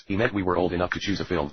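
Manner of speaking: usual speaking voice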